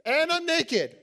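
high, loud mock proclamation; mock naked announcement. Pastor acting out showing up naked, mock alarm. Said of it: "if I all of a sudden was like".

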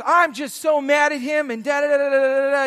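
imitated mad rant. Barista venting about his coworker, imitated mad rant. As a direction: play a guy fuming on and on. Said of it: "he's just mad".

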